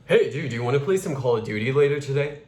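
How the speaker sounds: fast, run-together masculine delivery; brisk male-voiced question; quick male flow. Shown as a demonstration. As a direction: keep the vowels short and move briskly. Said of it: "Completely different flow".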